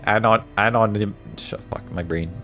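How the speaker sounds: usual speaking voice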